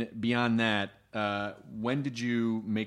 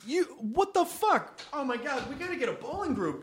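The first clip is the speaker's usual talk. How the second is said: amazed; 12 semitones higher than usual. Real, not acted. Amazed by bowling score.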